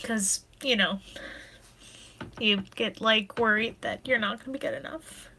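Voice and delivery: hushed, tearful confession; soft voice, fighting back tears with long pauses; real crying. Real, not acted. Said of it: "I'm sorry for crying".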